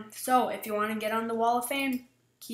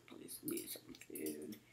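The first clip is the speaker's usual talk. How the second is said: whispered, pleading for food; whispered plea; 16 dB quieter than usual. Real, not acted. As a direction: whisper the plea under your breath. Begging again, whispered.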